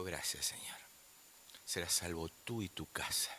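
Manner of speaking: low, soft, whispered gratitude; hushed thanks. Retold or imitated. Preacher retells thanking God for the young ones, hushed. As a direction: whisper it as a private prayer.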